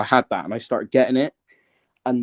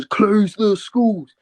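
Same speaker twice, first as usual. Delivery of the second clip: high, drawn-out mock shout; shouting down the phone; 10 semitones higher, much slower than usual. Re-enacting a viral work-van video, drawn-out mock shout. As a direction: call it out slowly, pitched right up. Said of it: "shouting down the phone".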